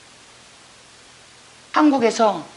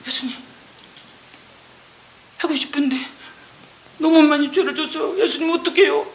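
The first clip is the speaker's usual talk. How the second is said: tearful prayer; 4 semitones higher than usual. Retold or imitated. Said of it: "간절히 울면서 기도하니까".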